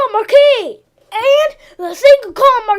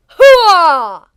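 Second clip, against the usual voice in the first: low barked army cry; 7 semitones lower than usual. Acted. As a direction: belt out a soldier's battle cry. Boot camp skit battle cry.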